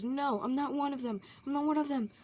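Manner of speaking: loud, high, frantic denial; acted-out freak-out, insisting loudly; panicked breakdown. A narrator's reading. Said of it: "he's just having a breakdown".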